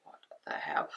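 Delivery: breathless whispered disbelief; whispered shock. Retold or imitated. Watching boyfriend run outside in nightie, whispered shock. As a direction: whisper it, stunned. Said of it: "I was like".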